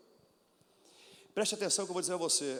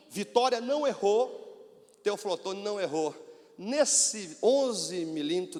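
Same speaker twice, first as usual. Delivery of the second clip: lento e mais forte, palavra por palavra; ênfase de pregação; 3 semitones higher, 5 dB louder, slower than usual. Acted. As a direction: martela cada frase para a igreja. Testemunho enfático na igreja. (ênfase pausada de pregador)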